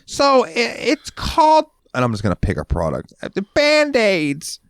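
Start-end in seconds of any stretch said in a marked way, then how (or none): 0.1-1.7 very high, slow, drawn-out dopey voice; a customer phoning the store, voiced very high and slow; clueless caller impression. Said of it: "And they're like". 3.2-4.6 very high, slow, drawn-out dopey voice; a customer phoning the store, voiced very high and slow; clueless caller impression. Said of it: "And they're like".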